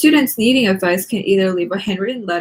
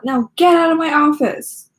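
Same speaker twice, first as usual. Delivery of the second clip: higher, louder grumpy bark; grumpy order; 7 semitones higher, 4 dB louder than usual. Acted. Teacher dismissing Nikki from his office, grumpy and loud. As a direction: throw her out of the office.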